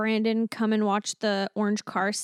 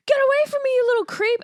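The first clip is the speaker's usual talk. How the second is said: much higher, mock-panicked squeal; mock panicked cop; 11 semitones higher, much faster than usual. Cop fleeing the leprechaun in the forest, high squeal. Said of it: "he's like".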